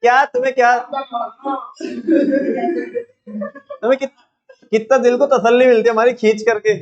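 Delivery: real, half-amused exasperation at being teased; exasperated scolding. Real, not acted. Teacher scolds students teasing him.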